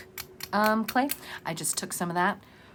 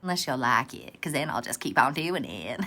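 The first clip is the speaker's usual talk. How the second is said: put-on Southern accent; 5 semitones lower than usual. Teasing viewers who might like it, Southern accent. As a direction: lean into a playful Southern twang. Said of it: "this random Southern accent came from".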